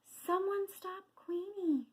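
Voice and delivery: high, feeble call for help; small-voiced quail. A narrator's reading. Quail pleading for help after theft, weak high voice. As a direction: call out without any power. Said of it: "Quincy's voice is too quiet".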